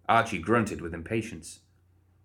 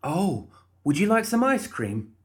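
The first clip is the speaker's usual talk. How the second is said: cheery Molly voice; 11 semitones higher, 4 dB louder than usual. Acted. Molly offering ice cream.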